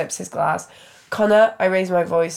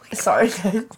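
ordinary talk, nothing marked

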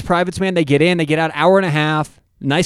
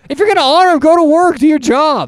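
high, raised-voice exasperation; heated rant; 10 semitones higher, 4 dB louder than usual. Real, not acted. Arguing against Labor Day off, heated high-pitched rant. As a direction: lay down the law like a fed-up coach. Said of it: "You sound like Bill Belichick".

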